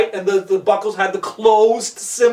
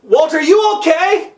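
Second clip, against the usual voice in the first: raised, high check-in from below; worried call; 6 semitones higher, 5 dB louder than usual. Acted. Jonathan to Walter after the slip, worried calling. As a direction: call up urgently to a friend.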